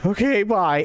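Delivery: caller hanging up with the dispatcher, high and relieved; relieved goodbye. Retold or imitated. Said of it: "phone call ending".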